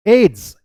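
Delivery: "एड्स" loud and high as a single syllable; said as one word. Shown as a demonstration. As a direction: announce "एड्स" as a word, not letters. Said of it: "ये एक word की तरह करते हैं". ("एड्स" एक शब्द की तरह, ज़ोर से)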